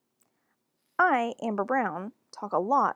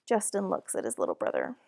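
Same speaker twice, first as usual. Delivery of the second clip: ordinary delivery, nothing marked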